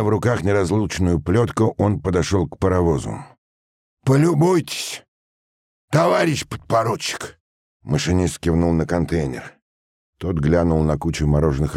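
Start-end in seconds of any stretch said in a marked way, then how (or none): 4.0-7.4 high, raised machinist's voice; indignant call. A narrator's reading. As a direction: call the officer over to see the outrage. Machinist calls officer to look. (возмущённо подзывает)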